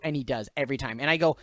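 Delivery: ordinary delivery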